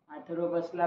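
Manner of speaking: plain reading voice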